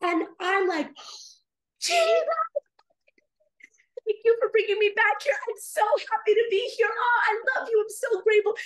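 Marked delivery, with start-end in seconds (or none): 1.8-8.5 high, loud, elated thanks; gushing. Retold or imitated. Back in the vision thanking Jesus, gushing. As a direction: squeal the name, then gush gratefully. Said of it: "I'm just gushing over this".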